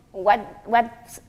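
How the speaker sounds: ordinary delivery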